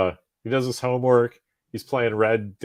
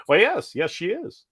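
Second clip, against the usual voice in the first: higher, mock-innocent father hanging up; dry dad tease; 8 semitones higher than usual. Father answering his daughter's caller, dry higher tease. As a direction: play the smug dad toying with the boy.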